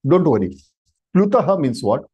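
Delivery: normal talking voice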